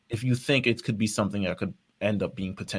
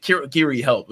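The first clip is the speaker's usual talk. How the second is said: raised, louder pleading call; mock cry for help; 4 semitones higher, 6 dB louder than usual. Host voices stabbed Shigaraki calling his ally, mock plea. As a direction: call out for rescue, playfully acted.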